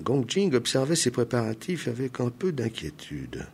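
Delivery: ordinary delivery, nothing marked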